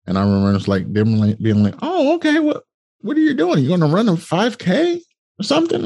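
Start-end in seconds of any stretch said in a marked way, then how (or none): 1.8-5.8 much higher, put-on clerk voice; store clerk voice. Shoe store clerk asking the customer, high put-on voice. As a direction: play the puzzled shop clerk way up high.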